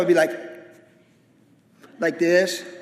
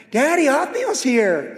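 daughter's voice; 7 semitones higher, 3 dB louder, slower than usual. Retold or imitated. Daughter voiced announcing suitor.

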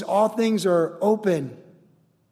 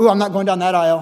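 quick, louder mock recoil; mock avoidance; 5 dB louder, faster than usual. Shopper avoiding someone who wronged them, mock recoil. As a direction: blurt it as if dodging someone.

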